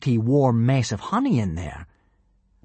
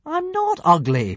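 higher, louder, slow indignant protest; protesting boy; 4 dB louder, slower than usual. A narrator's reading. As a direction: object with hurt pride. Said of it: "protested Hiccup".

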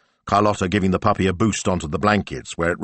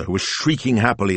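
ordinary delivery, nothing marked

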